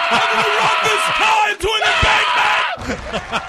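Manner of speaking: high, loud and slow with laughter; laughing shout. Acted. Friend shouting a threat while laughing. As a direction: bellow it through laughter.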